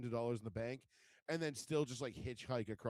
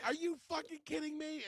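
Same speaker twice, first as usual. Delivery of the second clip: much higher, exasperated squawk; retelling his wife's disbelieving outburst, high and indignant; incredulous outrage. Retold or imitated. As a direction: imitate the wife's shocked fury. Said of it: "And she's like".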